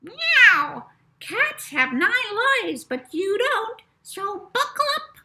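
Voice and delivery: a chirpy recorded cat announcing a safety reminder; taped cat voice. A narrator's reading. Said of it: "a different taped voice under her seat said".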